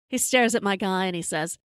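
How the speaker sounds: usual speaking voice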